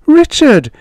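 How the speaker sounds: high, loud protest; sharp cry. Acted. Mother crying out to her husband, high and loud. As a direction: cut him off with his name.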